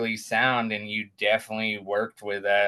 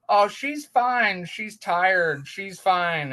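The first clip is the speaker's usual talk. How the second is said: high-pitched imitation of a breezy doctor; dismissive reassurance; 10 semitones higher, 4 dB louder, slower than usual. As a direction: brush the worry off, starting high and drawn out. Doctor brushes off worried parents.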